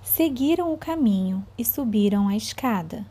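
slow, suspenseful storytelling; reading to children. A narrator's reading. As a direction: draw the kids into a spooky tale. Teacher reads story to children.

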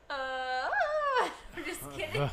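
high squealing laugh; loud high laughing fit. Real, not acted. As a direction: crack up mid-joke. Mock Irish phrase, bursts laughing.

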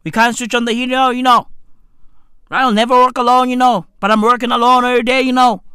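quick, monotone Merseyside grumble; mock Merseyside accent. Struggling Merseyside local listing hardships, mock Merseyside accent. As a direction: run it together in a put-on Merseyside accent. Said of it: "what accent is this?".

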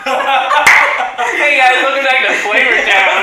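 loud, hyped TV chef host; Guy Fieri. Retold or imitated. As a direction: shout it like a diner-show host. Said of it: "Just a Guy Fieri's thing".

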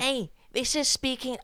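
mock Liverpool Scouse greeting, slow and soft; Scouse accent. Cohost introducing the bit in a mock Scouse accent. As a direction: put on a light Scouse accent. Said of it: "Speaking Scouse".

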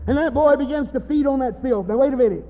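high, shouted pulpit outburst; full-force preaching. Real, not acted. Preacher warning about the boy and filth, shouted preaching. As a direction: preach it at the top of your voice.

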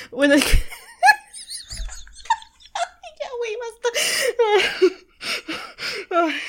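high squealing laughter she can't stop; real giggling fit breaking up the words; laughing fit. Real, not acted. Podcaster laughing helplessly at her own story, high squealing laughter. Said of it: "No sé cuánto tiempo me llevo riendo".

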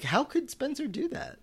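high, hushed mock disbelief; mock stunned whisper. Host replaying his reaction to Spencer, mock stunned whisper. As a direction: whisper it like a scandalized thought.

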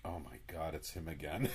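low, soft exasperation; weary groan. Pretend. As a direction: mutter it, dreading the call. Acted employee seeing call.

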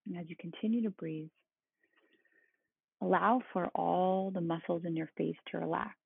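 soothing, unhurried meditation guide; calm meditative tone. Acted. Leads a guided body-scan meditation.

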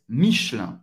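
"Michelin" as two-syllable mish-LAN; dropped E. Shown as a demonstration. Teacher showing learners the native form, dropped E. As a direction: swallow the middle E of "Michelin" entirely. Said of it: "O E desaparece".

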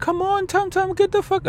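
squeaky high mock friend urging; imitating a friend coaxing him into the car; friend's voice. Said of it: "He's like".

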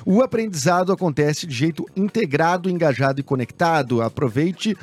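upbeat radio ad read. Acted. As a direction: read it like a sponsor spot. Reads sponsored ad.

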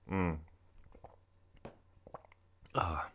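sipping between words; swallowing a drink mid-sentence. Real, not acted. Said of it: "Sorry, I'm drinking my recording again".

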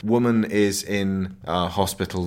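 ordinary delivery

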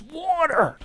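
a man dying of thirst barely gets the word out; parched desperate plea. Acted. Parched man begs again.

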